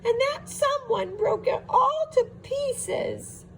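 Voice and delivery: very high crying squeak; Baby Bear crying. A narrator's reading. Baby Bear finding his broken chair, high crying wail. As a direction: wail it like a heartbroken cub. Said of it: "squeaked Baby Bear".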